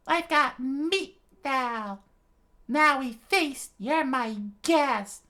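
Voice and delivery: slow, loud, very high giant voice; Brogy's hearty boast. A narrator's reading. Giant invites Usopp and Nami to feast, hearty shout. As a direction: proclaim it in drawn-out falsetto.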